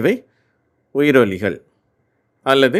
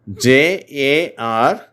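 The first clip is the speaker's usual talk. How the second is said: normal talking voice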